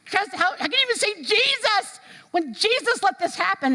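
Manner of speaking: high, challenging disbelief; high, strained outraged question; incredulous players. Retold or imitated. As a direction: press him, aghast. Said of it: "the players are like".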